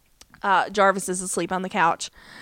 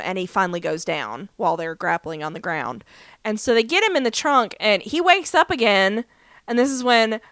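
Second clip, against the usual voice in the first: usual speaking voice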